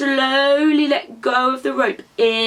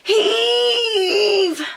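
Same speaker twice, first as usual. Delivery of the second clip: high hard effort shout; final big pull, shouting; shouted heave; 12 semitones higher than usual. Shown as a demonstration. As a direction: yank the rope with a big shout. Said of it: "One more pull".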